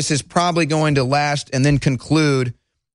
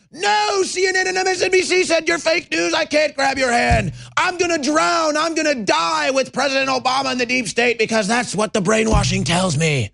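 high, whiny hammed-up panic; mock hysteria; 9 semitones higher than usual. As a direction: wail like a brainwashed victim, easing down at the end. Voices hysterical drowning people again.

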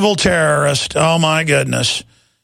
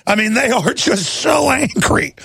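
ordinary talk

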